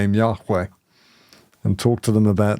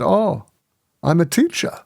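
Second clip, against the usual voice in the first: put-on higher voice, light Chinese accent; 6 semitones higher than usual. Visitor tells the speaker who he is, light Chinese accent. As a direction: answer simply in a mild Chinese accent. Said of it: "in English with a Chinese accent".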